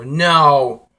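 loud, dreading groan. Real, not acted. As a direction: cry out in dread. Dreading the wasabi sauce.

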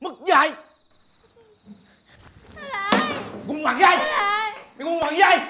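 enraged father losing control; furious insulting. Acted. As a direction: hurl the abuse at him. Father insults son in fight.

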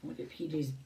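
usual speaking voice

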